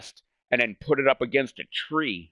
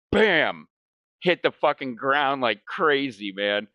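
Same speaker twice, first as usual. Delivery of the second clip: usual speaking voice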